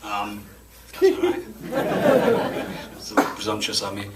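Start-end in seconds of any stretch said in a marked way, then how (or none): 0.9-1.4 giggling through the request, pitched up; sheepish laughing ask. Real, not acted. Awkward ask of the audience. 1.5-2.9 short embarrassed high laugh; awkward giggle; sheepish laugh. Real, not acted. Laughs after own presumptuous ask.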